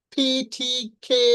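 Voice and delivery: ফি, ঠি, খে with a strong puff of air; aspirated sounds. Shown as a demonstration. Teacher demonstrates aspirated consonants. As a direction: push air out on ফি, ঠি, খে. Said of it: "aspirated sound".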